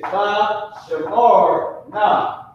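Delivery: very slow "Tha shamarna", rising at the end; ancient pronunciation. Shown as a demonstration. Teacher showing the class the ancient form, very slow. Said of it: "And in the ancient".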